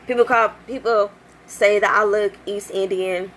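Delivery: normal talking voice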